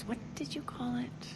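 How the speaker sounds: half-whispered, to herself; whispered aside. Acted. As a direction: whisper it while searching for the word. Aside, searching for a word.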